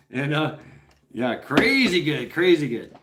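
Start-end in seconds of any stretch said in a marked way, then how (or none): none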